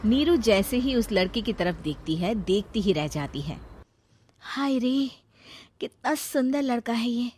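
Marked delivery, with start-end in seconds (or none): 4.4-7.3 higher, dreamy swoon. Acted. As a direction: sigh over the handsome boy. Neeru swooning over boy.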